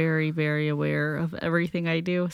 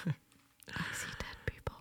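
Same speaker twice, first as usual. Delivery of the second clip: slow, eerie mock whisper; hushed, drawn-out whisper for a joke; spooky whisper; 16 dB quieter, slower than usual. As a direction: whisper it like a haunted confession. Spooky movie line as joke reply.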